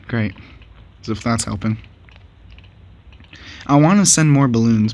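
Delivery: ordinary talk, nothing marked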